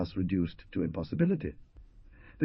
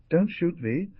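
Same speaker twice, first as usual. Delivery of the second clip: high, mildly alarmed plea; understated cry of terror; terrified cry; 8 semitones higher, slower than usual. A narrator's reading. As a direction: plead in alarm, pitched high without real shouting. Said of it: "shouting in terror".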